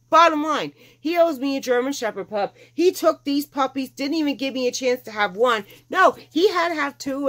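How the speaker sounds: high-pitched, mock-aggrieved rant; going on and on. Owner tells laughing vet staff about her man, mock rant. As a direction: pile up the complaints for laughs, high and insistent. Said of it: "I'm going on and on".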